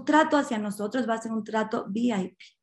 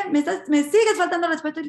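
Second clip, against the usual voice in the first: acted indignant question, higher and fast; angry retort; 5 semitones higher, 4 dB louder, faster than usual. Policeman feels disrespected by driver, angry retort. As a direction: fire back in mock outrage, quick and raised. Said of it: "se vuelve a enojar y le dice".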